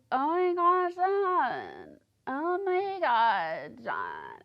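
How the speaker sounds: high, slow mumbling; sloppy drawn-out mimic of Lacey; slurred Lacey voice.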